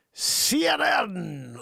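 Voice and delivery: loud, high, drawn-out station ident; mock announcer. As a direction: blare the network name very slowly. Mock network ident after headline.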